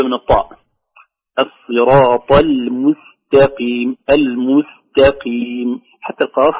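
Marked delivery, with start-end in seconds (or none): 1.4-5.8 slow recitation, heavy "الصراط" then light "المستقيم"; correct contrast. Shown as a demonstration. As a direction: stretch "المستقيم" with thin س and ت after emphatic "الصراط". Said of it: "والعكس في كلمة المستقيم". (تفخيم "الصراط" وترقيق "المستقيم" ببطء)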